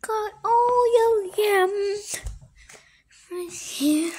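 playful sing-song whisper. Pretend. Playful whisper about yams.

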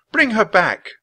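put-on high, loud, insistent girl; high-pitched angry command; furious demand. A narrator's reading. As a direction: order it back angrily. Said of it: "turned to the Christmas knight in a fury".

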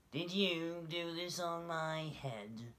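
high, slow, accusing mole voice; little mole asking. A narrator's reading. Mole questions the passing dove, slow high accusing voice. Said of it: "he asked the goat".

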